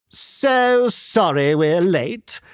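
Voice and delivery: slow, high, gushing society lady; breezy posh-lady apology, drawn out; gaily. Acted. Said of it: "she said gaily".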